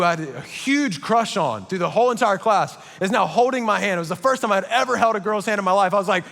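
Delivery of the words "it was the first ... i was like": normal talking voice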